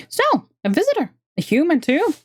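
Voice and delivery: squeaky creature snapping orders. Acted. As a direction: squeak it out, busy and curt. Voices the elf woman.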